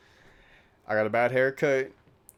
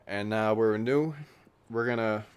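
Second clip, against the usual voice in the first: ordinary talk, nothing marked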